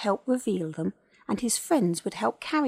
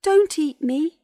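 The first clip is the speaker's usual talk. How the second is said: high, loud plea; small creature begging in fear; frightened wail; 9 semitones higher, 9 dB louder, slower than usual. A narrator's reading. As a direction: wail for your life. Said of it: "wailed Colin".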